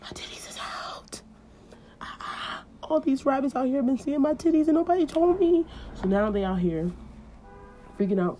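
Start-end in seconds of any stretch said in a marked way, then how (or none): 0.0-2.6 hushed mortified whisper; whispered panic; embarrassed whisper. Acted. 2.8-5.6 high indignant voice; high indignant outcry; outraged Eve. Acted. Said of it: "she's like".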